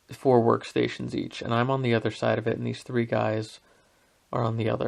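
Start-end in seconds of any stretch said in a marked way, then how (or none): none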